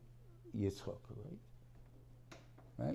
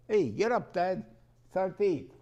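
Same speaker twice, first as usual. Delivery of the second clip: loud, higher call to wake dad; 8 semitones higher, 10 dB louder than usual. Acted. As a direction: shout up to your father to come eat. Son wakes father for meal.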